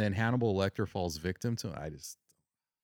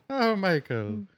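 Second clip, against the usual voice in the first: leprechaun; 9 semitones higher, slower than usual. Acted. Mock leprechaun wail at Michael.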